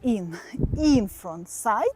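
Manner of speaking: loud, punchy dance-class count; counting the beat. Shown as a demonstration. Dance teacher counts students through the moves. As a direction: call each count to the class.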